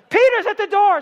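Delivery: very high, loud shout of the news; excited shout. Acted. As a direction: yell it through the house. Girl announcing Peter again.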